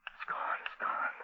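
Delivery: soft shaky whisper; relieved whisper. Acted. Stranded man whispers as creature leaves. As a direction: breathe it out, barely voiced.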